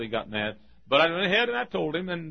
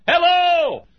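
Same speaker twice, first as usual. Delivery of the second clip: loud, high Texas holler of hello; calling out; 14 semitones higher, 7 dB louder than usual. Retold or imitated. Preacher calls into his open motel room, hollering. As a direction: holler it into the house like a doorbell. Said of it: "ringing the doorbell down in Texas".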